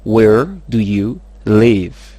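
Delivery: very slow, loud, words set apart; word-by-word model. Shown as a demonstration. Teacher has learners repeat, very slow word by word. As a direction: spell out the question word by word.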